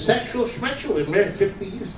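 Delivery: high-pitched scoffing; dismissive wife. Acted. Old wife to husband of fifty years, dismissive.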